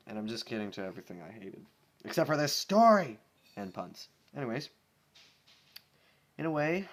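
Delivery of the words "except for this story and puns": loud sudden shout; joking outburst. Real, not acted. Reader takes back everything but the story, loud. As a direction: blurt it out teasingly. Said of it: "loud jokey outburst".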